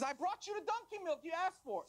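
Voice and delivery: high-pitched, proud repeat; insistent announcement. Acted. Traveler answering the startled king, insistent announcement.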